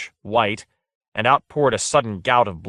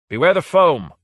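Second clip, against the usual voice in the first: much higher, loud battle call; called warning; 8 semitones higher, 7 dB louder than usual. A narrator's reading. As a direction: call out to the troops. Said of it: "called Lord Fade".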